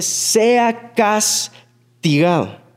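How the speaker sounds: slow drawn-out crowd chant; crowd verdict. Retold or imitated. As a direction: intone it like a whole mob passing sentence. Multitud en tribunal del pueblo. (veredicto lento de la multitud, arrastrado)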